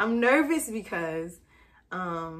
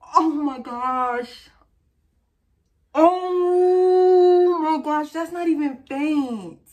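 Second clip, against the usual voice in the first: loud, high, long drawn-out words; stunned shock; 6 semitones higher, 9 dB louder, much slower than usual. Real, not acted. Wife seeing a positive test, stunned outcry. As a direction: wail it out in real astonishment.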